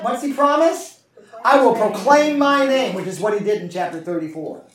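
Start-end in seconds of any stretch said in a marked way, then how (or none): 1.4-2.9 proclaiming. Acted. God's promise declared.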